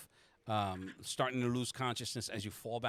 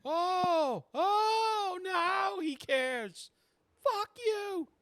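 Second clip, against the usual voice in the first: very high falsetto shout; high, loud, drawn-out outrage; sarcastic angry girlfriend; 5 dB louder, much slower than usual. Acted. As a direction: shriek it with scornful sarcasm. Abby's angry retort, acted by GM.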